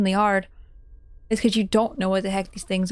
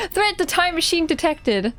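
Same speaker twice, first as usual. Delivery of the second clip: laughing through the word "Threat", higher pitched; 6 semitones higher than usual. Real, not acted. Streamer fixes the misread robot warning, laughing. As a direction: reads the corrected robot line while still laughing. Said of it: "I'm crying".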